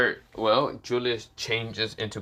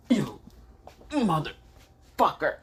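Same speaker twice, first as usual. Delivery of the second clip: pained curse; 7 semitones higher, slower than usual. Acted. Lucas pinned by swords, cursing.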